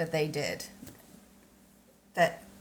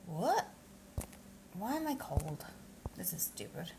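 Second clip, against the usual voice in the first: whisper; 10 dB quieter than usual. Real, not acted. Grumbling to herself before stream.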